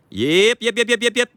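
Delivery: high, squeaky alien "yep, yep"; Sesame Street alien yip. Retold or imitated. Owner to dog, squeaky alien yips. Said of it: "that's from Sesame Street, the aliens".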